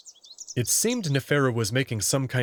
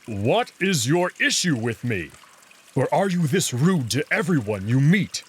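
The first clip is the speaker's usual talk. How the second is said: gruff wolf voice; 2 semitones higher, 4 dB louder than usual. Acted. Big wolf demanding answers from the rude jackal, gruff and annoyed. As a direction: bristle at him, gruff and annoyed.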